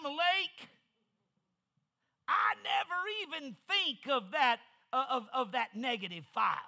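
high, loud preacher's cadence; preaching at full force. Real, not acted. Preacher urging the congregation to forgive, full force. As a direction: drive it home to the congregation.

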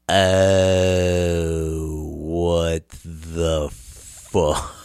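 very slow, stretched-out dismay; drawn-out disbelief. Retold or imitated. Man reacting to chicken prices, long-drawn disbelief. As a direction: drag every word out, low and stunned.

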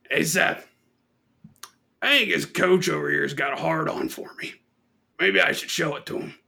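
lightly voiced grizzled old soldier; a touch of a crusty war veteran; grizzled vet. Drunk vet confiding in Seth, mild grizzled voice. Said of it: "he talked like he's a grizzled fucking vet".

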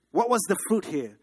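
usual speaking voice

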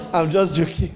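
ordinary talk, nothing marked

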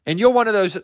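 ordinary talk

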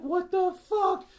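high, slow, drawn-out what the fuck; shocked disbelief. Retold or imitated. Teen seeing a huge lizard in a pet shop, stunned high drawn-out disbelief.

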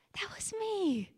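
whispered, far off; hushed whisper of recognition; whispered to herself. Real, not acted. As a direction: breathe it out as a private memory. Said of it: "As my voice still cracks".

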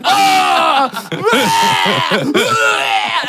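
high yelping cries while vomiting; mock panicked yelps; retching yells. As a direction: yell it out, high and wild. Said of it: "I'm like".

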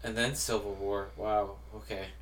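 ordinary delivery

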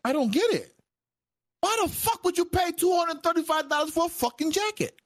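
high, incredulous mock shout; retold exasperation. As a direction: act out shouting in disbelief at the price. Retold disbelief at costly coat.

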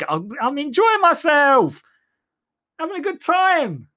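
high, gleeful caricature of himself. Acted. Mocking his future self in the writers' room, high and gleeful. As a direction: squeal it like a delighted fool.

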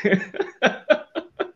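a short bright laugh; brief embarrassed chuckle; light laugh. Real, not acted. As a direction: laugh at yourself, amused. Laughs at his own excess.

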